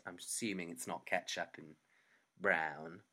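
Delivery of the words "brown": usual speaking voice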